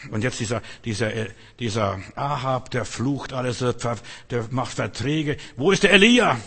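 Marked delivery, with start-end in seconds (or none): 5.6-6.4 high, loud, fast preaching cry; fiery preaching shout. Acted. Preacher crying out to the congregation about Elijah, shouting. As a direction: build to a thundering climax.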